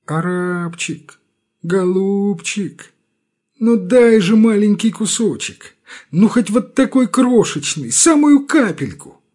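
slow then high pleading whine; humble begging. A narrator's reading. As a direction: grovel for a crumb. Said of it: "униженный нищенский голос".

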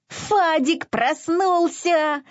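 high, slow happy cry; joyful. A narrator's reading. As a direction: burst out gladly. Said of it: "радостно захрюкал он".

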